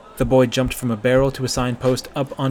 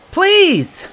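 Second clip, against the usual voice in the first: desperate plea; 8 dB louder than usual. A narrator's reading. Boy begs guard.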